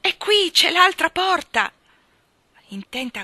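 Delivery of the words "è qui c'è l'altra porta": high, loud cry of discovery; child's breakthrough cry, pitched high; excited shout. A narrator's reading. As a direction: shout urgently to your brother. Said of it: "guardò alla finestra e gridò".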